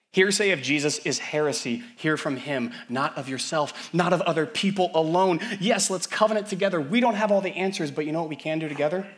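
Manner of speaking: high, loud ringing declaration; impassioned preaching. Acted. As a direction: proclaim it triumphantly. Preaching at full force.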